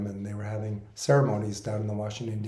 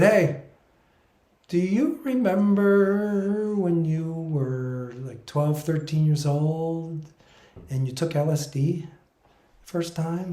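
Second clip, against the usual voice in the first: higher, slow teasing spirit voice; humorous spirit voice; 6 semitones higher, 5 dB louder, slower than usual. Retold or imitated. Spirits reminding him of his youth, high teasing voice. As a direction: play the spirit guide coaxing a memory. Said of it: "the way they speak is humorous".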